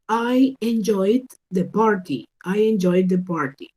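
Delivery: "I enjoyed the party" said slowly and carefully, word by word; slow model sentence. Shown as a demonstration. Teacher reading an example sentence slowly for students. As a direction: model it slowly for learners to copy. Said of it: "yo digo enjoyed".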